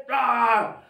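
drawn-out pretend roar; lion roar. Shown as a demonstration. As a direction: roar like a lion for little kids. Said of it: "do really scary lions".

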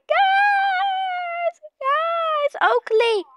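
high, loud cries for help; panicked screaming. Pretend. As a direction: scream in playful panic as the monster closes in. Monster coming, calls for help.

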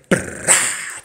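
unvoiced breathy "Brah!". Real, not acted. Host signing off with a breathy catchphrase. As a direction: puff it out. Signature sign-off.

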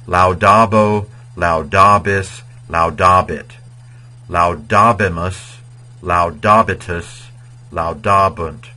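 slow, loud "Laudabo, laudabis" syllable by syllable; careful pronunciation. Shown as a demonstration. Teacher models the future forms for students, slow careful pronunciation. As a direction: say each form clearly and slowly. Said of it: "let me pronounce the future".

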